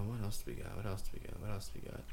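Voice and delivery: quiet mumble under the breath; muttering to self. Real, not acted. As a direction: mutter it to yourself, barely audible. Searching notes, talking to himself.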